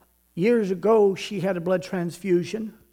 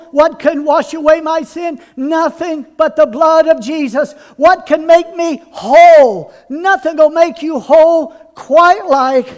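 louder and higher, preaching it home; rousing revival preacher, louder with each line; preaching at full force; 5 semitones higher, 7 dB louder than usual. Acted. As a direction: build the sermon up to a shouted climax. Sermon builds to climax.

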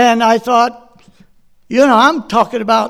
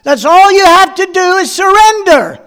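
screaming back; 7 semitones higher, 5 dB louder than usual. Retold or imitated. Preacher shouting back at the arrested woman on TV. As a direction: yell the order at her, dragging it out. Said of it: "I'm screaming back at her".

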